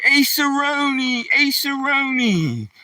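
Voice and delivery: high sing-song dog call; pet name call. Retold or imitated. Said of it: "and I used to say".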